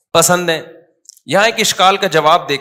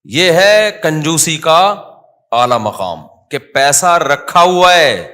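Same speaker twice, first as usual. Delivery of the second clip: slow and louder, every word pressed; emphatic preaching; 5 dB louder, slower than usual. Real, not acted. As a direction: drive home the point, slow and heavy. Preacher stressing the peak of miserliness. (آہستہ، زور دار انداز میں ہر لفظ پر زور)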